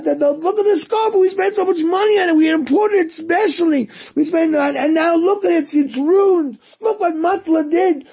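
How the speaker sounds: very high, strained ranting; hammed-up furious mother, slowing at the end; hysterical mother. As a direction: lament the carpet in a frantic rush. Mother ranting over ruined carpet.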